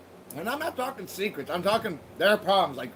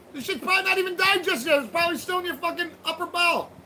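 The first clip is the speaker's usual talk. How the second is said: much higher, excited disbelief; teasing a forgetful viewer, pitched up high; incredulous teasing; 11 semitones higher than usual. Real, not acted. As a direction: rant in high amazed disbelief.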